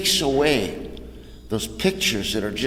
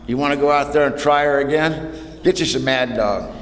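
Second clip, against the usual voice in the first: ordinary talk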